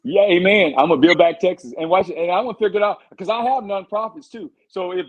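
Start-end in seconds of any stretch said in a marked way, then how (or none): 0.0-2.8 louder, proud campaign declaration; rallying cry. Real, not acted. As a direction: proclaim it like a slogan.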